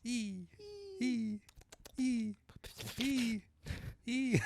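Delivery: high repeated squeals; mock steam whistle; imitated kettle whistle. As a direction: squeal "e" in short, evenly spaced high notes. Imitated pressure-cooker whistle after steam talk.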